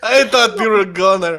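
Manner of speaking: relieved chuckle, still giggling; laughing relief. Acted. Fooled companion tells the half-orc she seemed dead, laughing. Character fooled by fake death.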